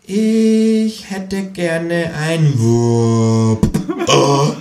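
extrem langsam, jede Silbe gedehnt; für Dumme. Acted. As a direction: buchstabier es dem Dummen vor. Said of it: "wiederholt das Känguru für Dumme". (extrem langsam und überdeutlich, wie für Dumme)

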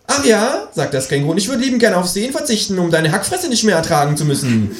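high, fast retort; snippy kangaroo. Acted. Kangaroo insults the narrator, high and snippy. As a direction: snap back at him.

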